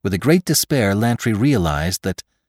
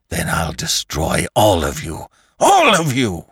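screamed threat. A narrator's reading. Lantry threatens all the living, building to a scream. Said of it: "screamed Lantry".

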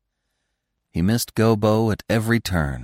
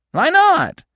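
loud, very high gleeful squawk; 6 dB louder than usual. A narrator's reading. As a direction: fling it out high, like a delighted bird. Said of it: "said the woodpecker in high glee".

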